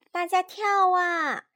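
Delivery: high, loud rallying cry; storybook teacher shouting to the class; teacher's shout. A narrator's reading. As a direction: call out for everyone to jump. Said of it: "卷毛老师大喊道".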